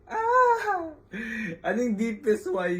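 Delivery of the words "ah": sharp high squeal of nerves; nervous squeal. Real, not acted. Host bracing to ask about his mother, nervous squeal. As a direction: let out a high anxious yelp.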